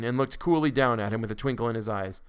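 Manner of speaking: ordinary delivery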